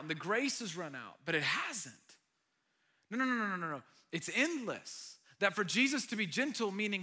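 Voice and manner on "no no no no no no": ordinary talk, nothing marked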